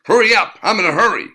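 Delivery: high, loud barked demand; barking. A narrator's reading. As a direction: bark it at him, impatient.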